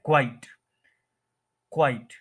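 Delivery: "क्वाइट" as kwite, one beat; single syllable. Shown as a demonstration. Teacher demonstrating quite, one-syllable pronunciation. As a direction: say "क्वाइट" without a second syllable. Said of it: "इसका एक ही syllable होता है".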